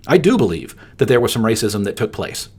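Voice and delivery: ordinary talk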